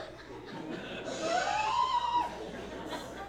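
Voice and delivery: very high shrieking mock laugh; mock cackle. Wife cackling at husband's excuse, very high mock laugh.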